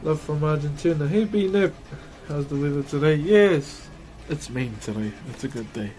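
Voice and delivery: ordinary delivery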